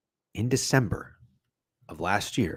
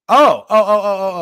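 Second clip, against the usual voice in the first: high, loud string of ohs; real burst of loud, high ohs; sudden realisation; 10 semitones higher, 11 dB louder than usual. Real, not acted.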